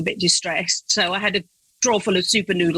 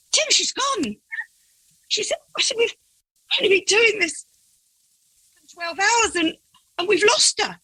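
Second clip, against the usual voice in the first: much higher, half-laughing alarm; retold panic; 12 semitones higher than usual. Panicked telling husband she's gone.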